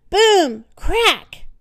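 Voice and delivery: loud, high sound words; dramatic boom. A narrator's reading. Narrator telling the bed crashing, loud boom. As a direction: make the floor crash. Floor breaking under bed.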